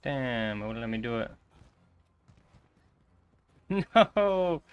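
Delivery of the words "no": loud, high laughing no; laughing shout. Real, not acted. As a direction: laugh then yell no in dismay.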